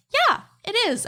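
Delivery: high-pitched, perked-up mimicry; imitated pleased reply. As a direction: act out her sudden delighted yes. Acts out lady's delighted reply.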